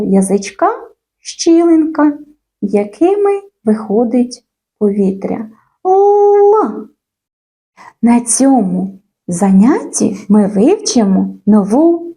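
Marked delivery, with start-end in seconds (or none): 5.9-6.9 Л sustained on a high, level pitch; drawn-out L. Shown as a demonstration. Teacher shows how air escapes round the tongue, drawn-out Л. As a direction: hold Л long so the air flows. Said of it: "Давайте разом вимовимо цей".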